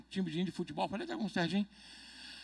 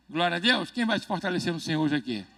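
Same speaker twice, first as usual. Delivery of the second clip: usual speaking voice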